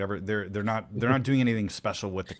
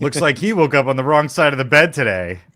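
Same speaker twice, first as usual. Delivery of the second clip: loud, high hammed-up one-liner; cheesy movie quip; 6 semitones higher, 12 dB louder than usual.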